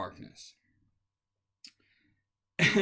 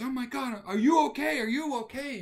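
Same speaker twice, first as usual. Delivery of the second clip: panicked mother; 10 semitones higher, 3 dB louder than usual. Retold or imitated. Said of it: "And she goes".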